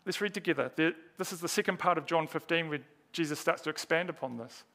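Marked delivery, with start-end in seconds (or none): none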